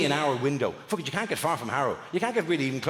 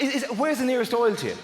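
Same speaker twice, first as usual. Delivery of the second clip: usual speaking voice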